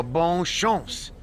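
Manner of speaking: drawn-out bonne chance in French; slow, showy French pronunciation of bonne chance; French phrase. Acted. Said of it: "as the French would say".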